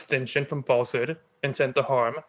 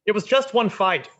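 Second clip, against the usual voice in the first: loud and higher, pleading his case; defensive protest; 8 semitones higher, 8 dB louder than usual. Acted. Stable boy protesting to the superior, loud defensive outburst. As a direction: blurt out the excuse loudly.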